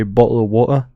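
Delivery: lightly imitated Essex British accent; a touch of put-on Essex. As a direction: quote it in a mock Essex accent. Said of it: "the Essex British accent".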